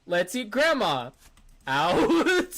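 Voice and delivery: "Let's eat grandma" then a pause, "out." high and loud; pause before out. Shown as a demonstration. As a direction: hold a beat, then pop "out.". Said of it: "That's where you put the comma".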